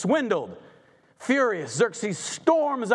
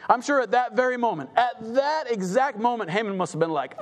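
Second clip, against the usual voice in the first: ordinary talk, nothing marked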